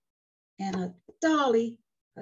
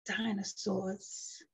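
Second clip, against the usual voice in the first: DINOSAURS voiced, slow and soft; slow storybook reading; 5 dB quieter, slower than usual. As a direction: read DINOSAURS out slowly. Reads the word again slowly.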